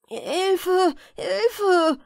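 high, loud cry for help; terrified cry. A narrator's reading. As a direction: scream for help in sheer terror. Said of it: "schrie er jetzt, von Entsetzen erfasst". (entsetzter Hilfeschrei)

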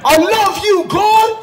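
high, loud, full-voiced shout; impassioned high yell; screamed to God. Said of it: "I scream at the top of my lungs".

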